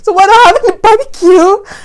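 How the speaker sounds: high, loud baffled question; husband realizing there is no barbecue, surprised; puzzled surprise. Retold or imitated. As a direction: realize the plan was fake. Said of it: "I was like".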